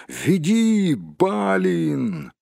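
high, long-drawn calling out; acted group shout; crowd's cry. A narrator's reading. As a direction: call to the leader, stretching the name. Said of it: "закричали остальные".

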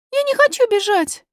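put-on high-pitched little-girl objection; little princess refusing. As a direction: protest as a small girl.

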